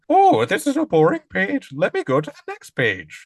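high, loud Grover voice with an Irish tinge; a bad Grover that drifts Irish; terrible Grover voice. Host demonstrating his own Grover voice to his co-host, high and loud. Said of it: "My Grover voice is terrible".

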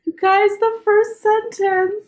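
high, slow, tearful and broken; choking up over the first sentence; crying. Real, not acted. A reader moved to tears by a book's opening. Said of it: "then we cried a whole bunch".

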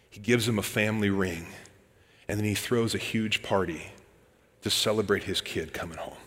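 soft, hushed picture of the embrace; tender hushed storytelling. Acted. Preacher gently describing the father's embrace, soft and hushed. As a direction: linger gently on the hug.

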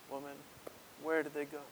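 soft, gentle Jesus voice; quietly asking where they went; tender question. Acted. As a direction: ask her gently, as if to comfort. Jesus speaks tenderly to the woman.

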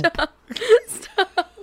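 high, loud laughing "stop". Real, not acted. Wife pleads with her laughing husband, laughing. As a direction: beg him to stop through giggles.

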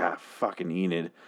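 low, quiet, fed-up "Ah, fucking Enid"; disgusted groan. Shown as a demonstration. Talking about an annoying show character, muttered with disgust. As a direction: sigh it out with contempt. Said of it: "you have to say fucking Enid".

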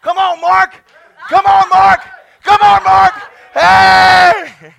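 loud, high exhortation; urging shout. Real, not acted. Preacher calling congregant up to rejoice, urging shout. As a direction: cheer him on at full voice.

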